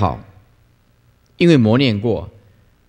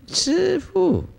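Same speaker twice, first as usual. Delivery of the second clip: put-on much higher, softer, tiny child's call; 7 dB quieter than usual. Mother prompting her toddler to greet the master, tiny high child's voice. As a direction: squeak it like a toddler calling out.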